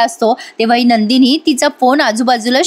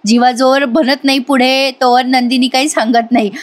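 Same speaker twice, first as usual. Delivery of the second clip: ordinary delivery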